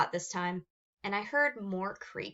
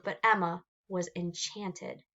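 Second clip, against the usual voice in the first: ordinary delivery, nothing marked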